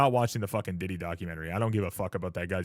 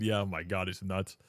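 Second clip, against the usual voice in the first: ordinary delivery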